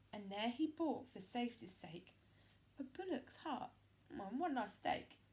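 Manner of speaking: plain reading voice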